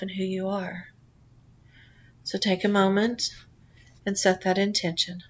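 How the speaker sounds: calm guided relaxation read; guided meditation. Acted. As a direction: lull the listener gently into the journey. Leads a guided past life meditation.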